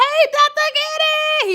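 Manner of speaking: very high, slow, sing-song hello; drawn-out squeal of a hello; excited greeting. Retold or imitated. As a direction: gush a bright hello to the doctor. Greeting the surgeon at pre-op.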